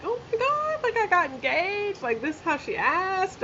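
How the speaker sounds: mock excited social media announcement; gushing newly engaged poster. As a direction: squeal it like a thrilled girl showing off her ring online. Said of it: "tell everybody that they love, like".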